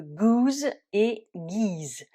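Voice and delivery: very slow "goose" with u then "geese" with i. Shown as a demonstration. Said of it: "le double O se transforme en double E".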